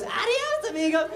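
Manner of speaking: high, put-on sign voice; cheery road sign. Road sign bidding drivers farewell, high put-on voice. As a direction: chirp a farewell in a mock-sign voice. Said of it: "does it say".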